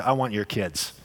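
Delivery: put-on the devil, low and soft, wanting the kids; lightly voiced devil, low and hushed; low, quiet devil voice. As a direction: murmur it low like a tempter. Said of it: "what the devil would always say".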